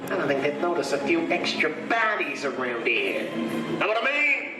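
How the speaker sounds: lightly voiced movie gangster; cocky gangster. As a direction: play the tough crook giving orders. Plays gangster figure in ride.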